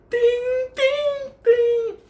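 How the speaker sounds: high ringing ding ding ding; imitating bat clang. Imitating the bat hitting the pavement, high clanging dings. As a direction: sing out each clang high.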